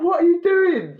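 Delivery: high loud laughing disbelief; disbelieving cry. Retold or imitated. Workmates at the boss's paperwork, loud high disbelief. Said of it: "we was all like".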